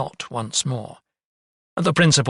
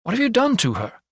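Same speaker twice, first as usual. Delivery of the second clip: much higher, alarmed demand; sudden outburst; 11 semitones higher than usual. A narrator's reading. Young doctor confronts physician over patient's treatment, sudden outburst. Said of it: "indignant rather than frightened by the doctor's sudden outburst".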